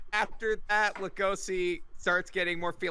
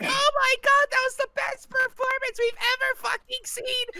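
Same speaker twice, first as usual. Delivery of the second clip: much higher, gushing praise; amazed audience. Retold or imitated. Crowd praising the staged fight, amazed gushing. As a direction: gasp it like a stunned fan.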